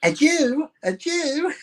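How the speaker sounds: very high-pitched sneeze imitation; mock sneeze. Co-host riffing on the rabbi joke, very high mock sneeze. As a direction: squeak it out like a fake sneeze.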